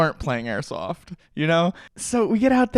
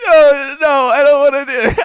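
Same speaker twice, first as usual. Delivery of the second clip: high, loud, half laughing half crying no; upset friend refusing, near tears; distraught refusal; 13 semitones higher, 11 dB louder than usual. Retold or imitated. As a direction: plead like you can't go on. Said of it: "He's like".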